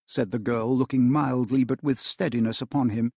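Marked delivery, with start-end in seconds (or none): none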